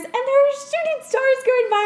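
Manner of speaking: rising with wonder at the memory; excited. Real, not acted. Excited recalling shooting stars.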